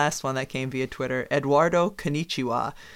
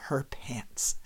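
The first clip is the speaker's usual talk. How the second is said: slow whisper for the punchline; whispered ending; 2 semitones lower, 10 dB quieter than usual. Pretend. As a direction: whisper the last line slowly. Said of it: "It would be something like this".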